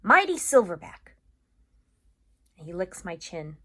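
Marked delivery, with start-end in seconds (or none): 0.0-1.1 higher and louder, rousing; rally your friend; Bob encouraging. Acted. Bob cheering up Ivan, rousing encouragement.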